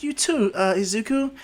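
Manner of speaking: put-on high-pitched girl voice; high girlish character voice; shy friendly girl. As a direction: answer high, with a little hesitation. Girl answers the hero's good wishes.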